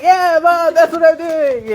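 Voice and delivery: high, loud laughing answer; laughing teen reply. Retold or imitated. Daughter laughingly brushing off her mom's warning. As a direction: blurt it out through laughter.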